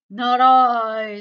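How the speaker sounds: loud dog voice, stretched out; storybook dog. A narrator's reading. Dog refuses to make the cake, loud drawn-out character voice.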